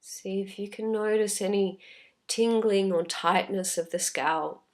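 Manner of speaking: unhurried, calm meditation voice with long pauses; guided meditation. Real, not acted. Teacher leading listeners through a body scan, calm guided meditation. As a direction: gently guide them inward, leaving space after each phrase.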